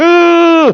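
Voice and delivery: mock scared ooh. Teens mock-scared of adults.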